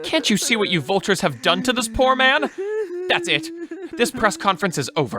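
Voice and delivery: high, indignant reproach. Acted. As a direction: rebuke the press and call it all off. Spokesman defends sobbing director, ends conference.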